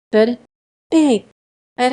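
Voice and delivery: loud, high burst; sudden outburst. A narrator's reading. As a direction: blurt it out loud.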